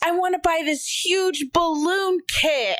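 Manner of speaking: put-on high, slow little-kid voice; imitated wanting child. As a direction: drag it out like a child begging for a toy. Imitates son wanting a toy.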